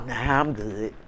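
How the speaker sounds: low, quiet, flat brush-off; low flat refusal. Retold or imitated. Turning down friends' invitations, low and quiet. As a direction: drop it low and uninterested.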